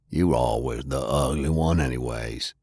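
low, bitter brother's taunt; Maddie's voice. A narrator's reading.

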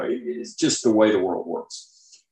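normal talking voice

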